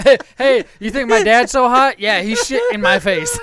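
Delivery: lightly voiced, loud indignant daughter; daughter telling teacher. Daughter tattles on dad to teacher, mock indignant. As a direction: imitate a child blurting out a complaint.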